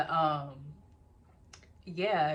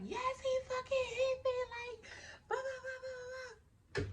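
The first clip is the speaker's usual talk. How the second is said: reenacted yelling, much higher, crying through it; much higher angry yell to the friends, tears in it; 4 dB quieter than usual. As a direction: shout back, high and tearful. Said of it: "But I'm like".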